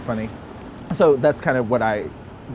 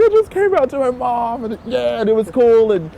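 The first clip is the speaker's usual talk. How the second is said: excited; 10 semitones higher, 7 dB louder than usual. Retold or imitated. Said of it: "I was like".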